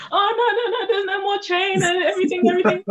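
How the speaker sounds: mock-wail that nothing works anymore; frantic denial. Student telling her dad there's no train, mock frantic. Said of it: "retelling her panicked reply".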